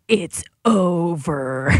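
drawn-out, mock-final pronouncement; mock doom. Mimicking gloomy think pieces to co-hosts, mock doom. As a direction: stretch it out like a hammed-up death sentence.

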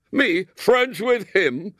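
put-on boy hurling the question back at his mother; exploding. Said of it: "exploded William".

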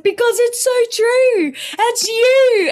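very high, slow, amused mother; amused mum voice. Retold or imitated. Mum laughing at her daughter, high amused voice. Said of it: "And she said".

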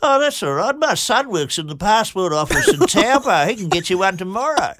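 put-on high, bright old lady voice; Missus Burrows. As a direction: imitate the sweet landlady, up high. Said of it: "Missus Burrows goes".

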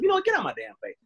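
high, put-on voice telling someone off; mock annoyed shout. Imitating partner fed up after a fart.

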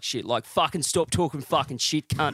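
mock bogan schoolmate rant; exaggerated Australian Facebook tough talk; broad Australian accent. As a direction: rattle off swearing like an Aussie lout. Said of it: "think of the Australian accent".

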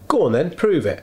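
sceptical customer daring you; challenging. Retold or imitated. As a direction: go on then, prove it, defiant. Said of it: "do almost have the attitude".

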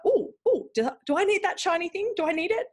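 high, cooing "ooh" of temptation; mock tempted excitement. Voices temptation by shiny thing.

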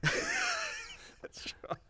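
squeaky laughing reply. Real, not acted. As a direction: laugh through the words.

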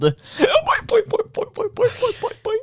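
high bouncing boing boing; spring up on every boing like a toy; boing catchphrase. Acted.

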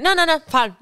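high, insistent brush-off; huffy refusal. Acted. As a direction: act out a sulking partner refusing help. Acted sulky refusal of help.

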